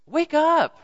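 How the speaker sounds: high, loud wake-up call; rousing shout. Real, not acted. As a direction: jolt them awake with a cry. Preacher rousing lukewarm church.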